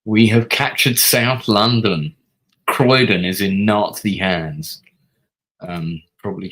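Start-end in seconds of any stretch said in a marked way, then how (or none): 0.1-4.8 slow, loud bulletin voice; hammed-up victory bulletin; mock war announcement. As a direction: proclaim it like a wartime newsreader.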